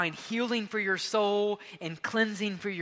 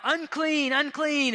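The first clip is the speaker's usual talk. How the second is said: calling out; 8 semitones higher, 4 dB louder, slower than usual. Retold or imitated. Lepers crying out unclean.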